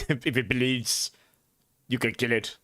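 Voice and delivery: weak attempt at Arnold; Arnold impression. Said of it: "I can't do that one very well".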